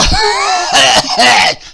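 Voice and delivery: loud cackling laugh. Acted.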